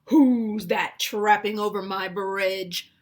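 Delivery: loud, slow troll challenge; booming, drawn-out troll demand; troll roar. A narrator's reading. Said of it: "roared the troll".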